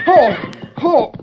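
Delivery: high, startled oh oh as the horse bucks; startled cry. Acted. Boy on a bucking horse cries out in alarm. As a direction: yelp as he's nearly thrown. Horse rears and throws him.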